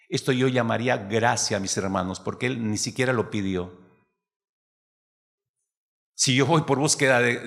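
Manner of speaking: ordinary talk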